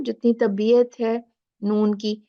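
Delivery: usual speaking voice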